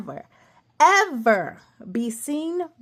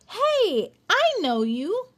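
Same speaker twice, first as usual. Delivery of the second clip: put-on surprised child voice; 8 semitones higher, slower than usual. A narrator's reading. Aria recognises the fairy.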